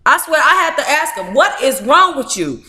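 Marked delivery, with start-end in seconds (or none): 1.3-2.6 higher, sharp demand; mock outburst; retold exasperated yell. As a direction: snap at him like a fed-up sister. Retold scolding of brother.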